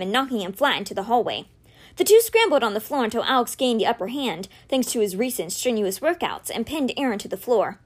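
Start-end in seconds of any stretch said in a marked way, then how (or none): none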